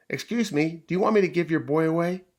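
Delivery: high, quick, loud puppy call. Acted. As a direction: shout the request over to the bulldog. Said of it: "So he called out loudly".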